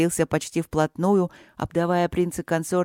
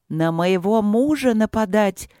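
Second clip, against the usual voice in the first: higher, louder, slow outraged yell; furious yelling; 4 semitones higher, 3 dB louder, slower than usual. Acted. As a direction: scold the spirit in a rage. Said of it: "в бешенстве орала королева".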